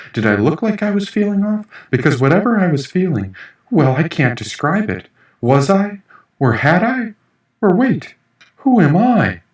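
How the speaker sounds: building anxious bewilderment; rising panic. Acted. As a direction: spiral into confused fright. Tripping narrator losing sense of self.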